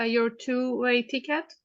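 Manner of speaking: usual speaking voice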